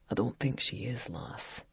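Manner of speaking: put-on low, hushed boy's whisper; hushed, trailing into breath. A narrator's reading. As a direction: drop to a near whisper, spooked. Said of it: "Jamie's voice became hushed".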